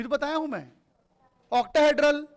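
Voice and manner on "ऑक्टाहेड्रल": loud answer. Acted. Teacher giving students the answer they missed, loud. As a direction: call the answer out loudly, a bit higher.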